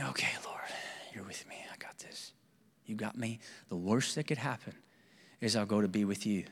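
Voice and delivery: hushed, breathy whisper to God; barely voiced, praying under his breath; whispered prayer. Man in hospital bed praying to the Lord, whispering.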